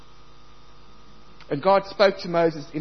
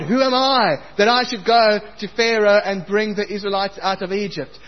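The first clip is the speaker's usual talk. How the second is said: Moses pleading; 6 semitones higher, 8 dB louder than usual. Acted. Preacher voicing Moses' protest.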